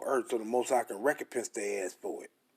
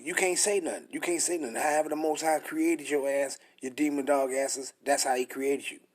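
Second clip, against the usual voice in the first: usual speaking voice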